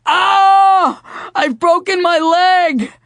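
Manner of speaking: high, loud put-on wailing; hammed-up cry of pain; fake wail. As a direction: overplay the injury with a howl. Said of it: "a very convincing wail".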